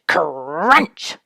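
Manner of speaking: usual speaking voice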